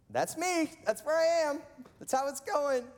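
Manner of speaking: very high, loud, squeaky outburst; mock wailing. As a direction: wail it out in comic self-mockery. Mimics own wailing reply, contrasting quiet friend.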